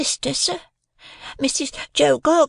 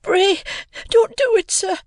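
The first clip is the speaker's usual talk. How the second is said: pleading in terror. A narrator's reading. Pip begs the man not to kill him, pleading in terror. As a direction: beg as a terrified little boy, pitched way up. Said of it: "I pleaded in terror".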